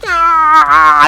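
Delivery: long, high, loud scream of agony; acted pain scream. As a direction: scream out as if badly hurt. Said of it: "You're yelling and screaming".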